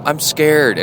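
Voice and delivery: a hint of a scared little boy; scared child. Nine-year-old son standing by his parents' bed in a storm, scared child. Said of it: "And he goes".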